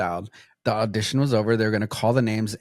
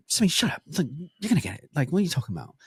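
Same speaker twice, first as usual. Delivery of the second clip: higher, soft mock-dismissive mom; retold scolding mom; 5 semitones higher, 4 dB quieter, much faster than usual. Mom dismissing her kid's doubts, mock scolding. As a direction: imitate mom hushing a doubter. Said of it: "she'd be like".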